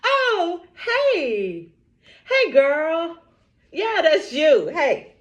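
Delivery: excited greeting. Real, not acted. Greeting live-stream viewers who just joined, excited.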